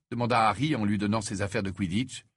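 ordinary delivery

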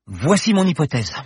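a proud announcement. Acted. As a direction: declare it with pride. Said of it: "déclara-t-elle fièrement".

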